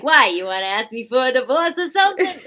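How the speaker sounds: loud, high comic husband outburst; Rodney Dangerfield. Acted. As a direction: ham up an incredulous husband, pitched high and loud. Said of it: "You sound like Rodney Dangerfield".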